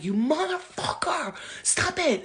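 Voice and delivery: high-pitched, slow mock rage; angry outburst. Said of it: "If I get angry with her".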